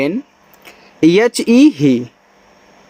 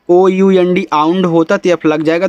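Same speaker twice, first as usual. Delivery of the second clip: ordinary talk, nothing marked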